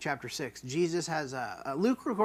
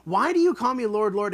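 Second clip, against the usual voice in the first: louder, higher, disbelieving; incredulous rebuke; 5 semitones higher, 7 dB louder than usual. Shown as a demonstration. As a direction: stress the absurdity. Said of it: "the ludicrousy of this".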